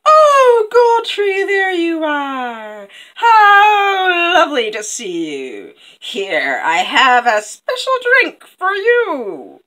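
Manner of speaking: high, loud, drawn-out false delight; gushing villainess. Acted. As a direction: greet your victim with swooping, hammed-up affection. Villainess greets Gold Tree.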